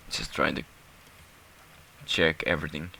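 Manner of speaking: ordinary delivery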